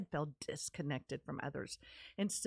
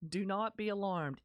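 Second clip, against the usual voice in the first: normal talking voice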